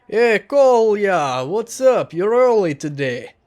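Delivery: a barman hailing a regular across the counter; bartender voice. Acted. Bartender greets the narrator.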